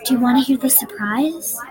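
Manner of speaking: ordinary delivery, nothing marked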